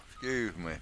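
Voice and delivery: talking with hiccups. Real, not acted. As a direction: speak while hiccuping. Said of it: "I got the hiccups".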